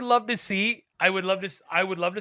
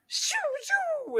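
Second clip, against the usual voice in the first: whooshing brain sound; 5 dB quieter than usual. Retold or imitated. Imitating brain firing noise.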